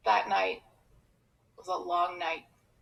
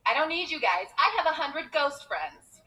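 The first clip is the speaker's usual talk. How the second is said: put-on higher-pitched childish bragging; mock boast; 5 semitones higher than usual. Child boasting to schoolmates, mock defiant. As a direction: brag to the other kids in mock defiance.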